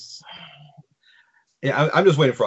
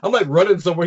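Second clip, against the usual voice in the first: normal talking voice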